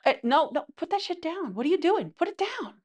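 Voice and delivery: high-pitched, incredulous telling-off; mock exasperation. Retold boyfriend telling girlfriend to stop, high mock exasperation. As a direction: act out an exasperated boyfriend stopping her.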